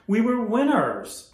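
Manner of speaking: slow, loud and high proclamation; proud declaration. Acted. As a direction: declare it slowly with pride. Boss reminding Rudy of glory.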